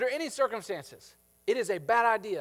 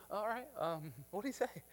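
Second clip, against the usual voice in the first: a little nervous; 3 semitones higher, 10 dB quieter than usual. Acted. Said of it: "a little nervous".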